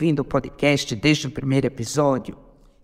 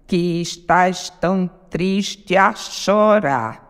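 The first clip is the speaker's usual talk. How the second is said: very slow, syllable by syllable; contando sílabas; slower than usual. Shown as a demonstration. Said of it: "Sete sílabas". (escandindo devagar, sílaba por sílaba)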